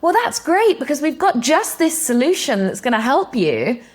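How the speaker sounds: high, loud, gushing sales pitch; eager salesman. Retold or imitated. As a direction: overeager sales rep cutting in. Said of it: "hearing sales reps going".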